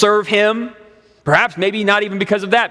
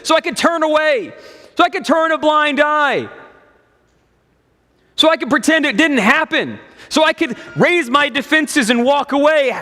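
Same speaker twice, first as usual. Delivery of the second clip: mock indignant; 6 semitones higher than usual. Voicing woman's mock outrage.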